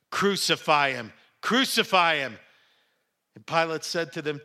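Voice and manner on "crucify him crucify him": loud repeated crowd shout; crying out. A narrator's reading. Priests and officers see Jesus, crying out. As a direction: shout it twice, hard. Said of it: "they cried out".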